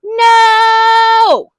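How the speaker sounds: high, very loud shout; father shouting at the cashier; loud yell. A narrator's reading. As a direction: yell it back. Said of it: "all yelled".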